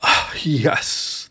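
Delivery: breathy, winded reply; the hippo, winded, replies; catching his breath. Acted. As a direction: answer while still out of breath. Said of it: "catching his breath".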